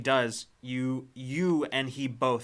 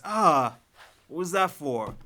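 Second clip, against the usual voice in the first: indignant yelp; 5 semitones higher, 8 dB louder than usual. Acted. Man swatted on shoulder by woman.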